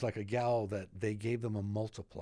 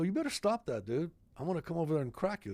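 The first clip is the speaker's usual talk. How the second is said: retold threat; 7 semitones higher, faster than usual. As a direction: warn him off like a tough guy, half joking. Threatening intruder in retold story.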